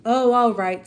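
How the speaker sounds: loud, cross give-in. A narrator's reading. Bear gives in to the gentleman, loud and cross. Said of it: "said the bear crossly".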